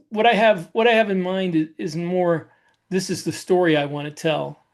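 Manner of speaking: ordinary talk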